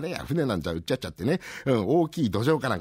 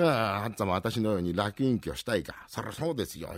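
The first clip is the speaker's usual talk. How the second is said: ordinary talk, nothing marked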